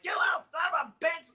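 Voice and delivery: high, fast mock shout at a kid; angry lady yelling. Avon lady grabs the boy's neck, shrill mock shout. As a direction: scold the punk who dented her car. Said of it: "shaking the back of my neck like".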